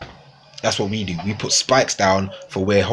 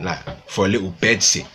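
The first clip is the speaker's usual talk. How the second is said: usual speaking voice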